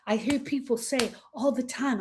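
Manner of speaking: ordinary talk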